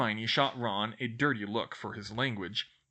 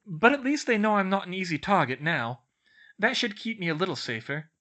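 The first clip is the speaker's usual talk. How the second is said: put-on high, louder studious girl voice; bookish girl explaining; 8 semitones higher, 6 dB louder than usual. A narrator's reading. Girl explains her maths book to friends, earnest.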